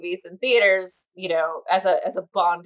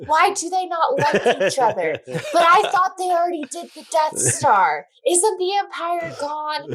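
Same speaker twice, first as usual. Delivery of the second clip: confused mom; 9 semitones higher than usual. Retold or imitated. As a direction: imitate a puzzled mom asking nonstop. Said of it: "she's just like".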